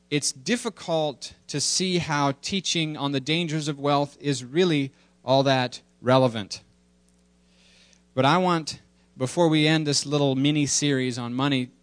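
ordinary delivery

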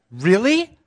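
high, loud, rising really; a young woman's surprised question. Retold or imitated. Quoting the girl's astonished reply, high and loud. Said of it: "And she said, like".

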